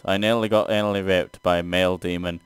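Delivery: normal talking voice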